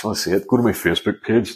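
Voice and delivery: normal talking voice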